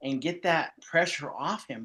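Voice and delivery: usual speaking voice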